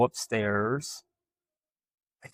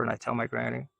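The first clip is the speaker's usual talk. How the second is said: usual speaking voice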